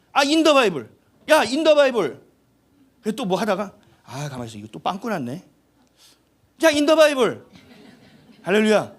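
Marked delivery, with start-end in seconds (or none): 0.1-2.2 calling out loud and high; a voice shouting to get his attention. Retold or imitated. God calls out his name. 4.0-5.4 muttered aside. Retold or imitated. God muttering to himself. 6.6-7.4 calling out. Retold or imitated. God calls out again.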